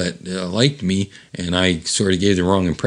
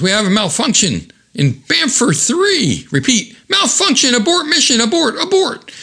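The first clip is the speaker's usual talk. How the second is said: rising, ever higher radio alarm; panicked pilot. Retold or imitated. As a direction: sound the alarm, climbing in panic.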